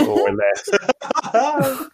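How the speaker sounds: laughing through the punchline. Real, not acted. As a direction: let the laugh take over. Laughing at own joke.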